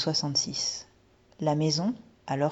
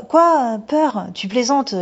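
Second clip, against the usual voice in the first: higher, louder, forced bravado; indignant outburst; 8 semitones higher, 9 dB louder, slower than usual. Acted. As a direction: burst out to hide the fear. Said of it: "d'un air bravache".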